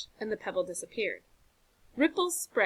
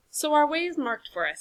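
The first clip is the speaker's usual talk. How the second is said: pleased and settled, a bit higher; satisfied; 6 semitones higher, 4 dB louder than usual. Acted. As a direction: sound content with the find. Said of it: "said John with satisfaction".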